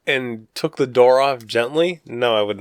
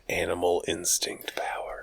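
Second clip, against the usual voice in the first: whisper; 5 semitones lower, 12 dB quieter than usual. Acted. Dramatic whispered aside.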